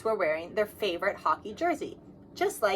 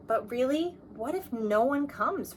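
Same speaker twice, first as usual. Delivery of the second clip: worried; 4 semitones higher than usual. Acted. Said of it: "Rosie asked again".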